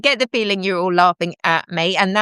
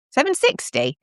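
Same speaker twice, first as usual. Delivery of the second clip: higher, questioning repeat; puzzled repeat; 6 semitones higher than usual. Shown as a demonstration. Repeating a viewer's odd time. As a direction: echo the odd time back in surprise. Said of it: "There's no such thing as seven sixty".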